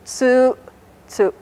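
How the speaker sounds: first tsu held long, second tsu short; long then short vowel. Shown as a demonstration. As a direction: stretch the first tsu, pause, brief second tsu. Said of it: "This one's long and this one's short".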